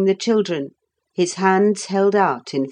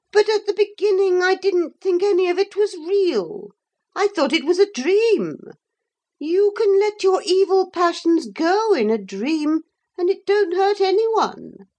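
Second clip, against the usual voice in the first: high, sulky common woman's voice; a resentful servant girl pleading her case; pitched-up narrated Pretenderette; Pretenderette's voice; 11 semitones higher than usual. A narrator's reading. Pretenderette voice held.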